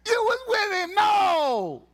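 very high, loud cry; hammed-up shouting exchange; mock shouting denial. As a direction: yell the accusation and refusal. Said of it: "he started cussing".

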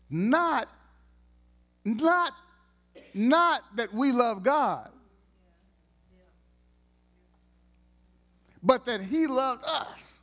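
slow emphatic preaching peak; preaching emphasis. Real, not acted.

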